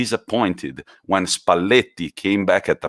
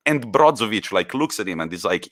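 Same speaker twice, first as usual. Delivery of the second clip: ordinary talk, nothing marked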